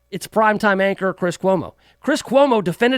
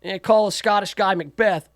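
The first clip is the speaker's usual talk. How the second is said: normal talking voice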